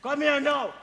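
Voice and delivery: usual speaking voice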